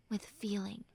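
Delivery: breathy whisper of reassurance. Acted. Friend whispering comfort to a hurting listener. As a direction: whisper it close, like a secret.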